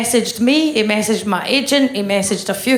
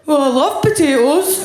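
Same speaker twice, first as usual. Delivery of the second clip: higher fake consoling voice; 7 semitones higher than usual. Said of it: "Every single one of them went".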